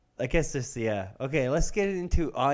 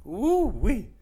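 excited whoop; 9 semitones higher, 3 dB louder than usual. Real, not acted. Exclaiming after a hit.

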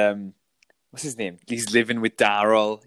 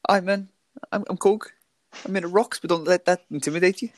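high Kiwi voice as Korg; New Zealand accent; 10 semitones higher than usual. Pretend. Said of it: "try and do, like, an, uh, Austra-, uh, New Zealand accent?".

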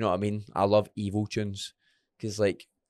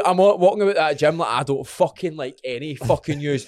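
ordinary talk, nothing marked

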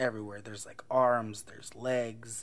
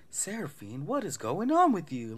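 high-pitched mock disbelief; hammed-up incredulous friend; bewildered retold question; 6 semitones higher, 3 dB louder than usual. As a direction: swoop up in exaggerated bafflement.

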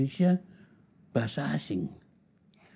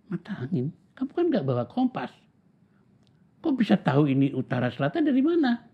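amazed disbelief; 6 semitones higher than usual. Retold or imitated. Boy amazed at friend's answer.

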